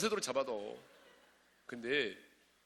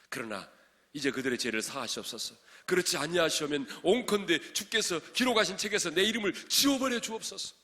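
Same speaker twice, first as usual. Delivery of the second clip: impassioned scripture reading; 5 semitones higher, 10 dB louder than usual. Acted. Preacher reading Moses' plea for forgiveness, building impassioned reading. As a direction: build to a high, loud plea.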